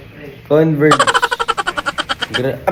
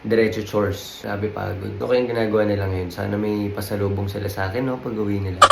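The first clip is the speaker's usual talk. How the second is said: soft, low weary murmur; tired; 4 semitones lower, 9 dB quieter than usual. Real, not acted. Worn-out vlogger after basketball and chores, low and drained. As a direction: let the day's fatigue sink the voice. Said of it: "Pagod ako ngayong araw".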